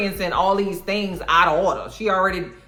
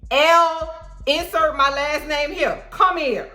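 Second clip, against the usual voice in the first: stern elder calling; 8 semitones higher, slower than usual. Retold or imitated. Voicing mad elder calling.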